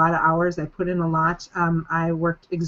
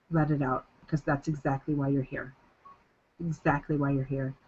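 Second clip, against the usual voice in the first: quieter, tender and sincere; soft and reassuring; 3 semitones lower, 7 dB quieter than usual. Real, not acted. Coach encouraging her team softly, tender and reassuring. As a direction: ease it out gently, like a promise. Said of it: "soft repeated encouragement".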